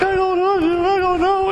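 very high, squeaky put-on dithering; mock reluctance. Imitating listeners scared to witness, squeaky mock reluctance. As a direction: squeal it like a nervous waverer.

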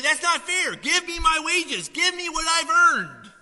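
loud, high-pitched protest, words stretched out; outraged mock yell, drawn out; indignant demand. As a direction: shout like a cheated worker demanding wages. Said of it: "You're like".

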